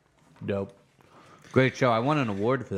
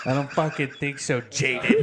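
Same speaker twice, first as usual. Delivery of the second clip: ordinary talk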